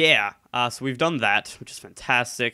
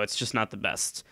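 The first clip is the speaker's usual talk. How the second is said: ordinary talk, nothing marked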